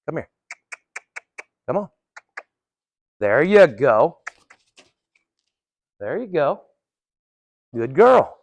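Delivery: calm a nervous horse; soothing. Real, not acted. Soothing the horse during training.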